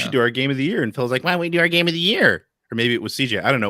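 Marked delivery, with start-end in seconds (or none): 1.2-2.5 high, whiny impression of a friend. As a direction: whine the question like a nag. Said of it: "Of all the impressions".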